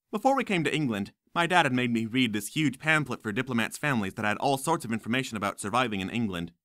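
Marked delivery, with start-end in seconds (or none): none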